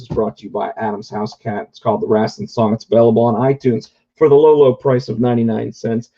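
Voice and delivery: ordinary talk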